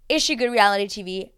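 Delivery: ordinary talk, nothing marked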